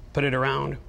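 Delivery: usual speaking voice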